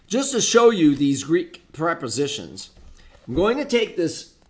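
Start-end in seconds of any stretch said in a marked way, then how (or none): none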